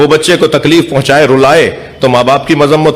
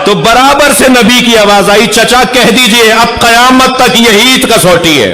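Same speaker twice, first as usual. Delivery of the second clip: raised, ringing majlis climax; preaching at full force; 7 semitones higher than usual. Acted. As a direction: lift the crowd to its peak.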